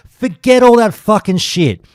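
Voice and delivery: loud, high, heated outburst; angry rant. Real, not acted. Podcaster ranting at warriors, angrily loud. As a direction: vent it hard, getting worked up. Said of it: "I'm getting angry, mad".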